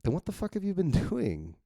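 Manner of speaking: high-pitched incredulous comeback; mock exasperation. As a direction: lightly act out an exasperated demand, pitched high. Heated comeback in dialogue read aloud.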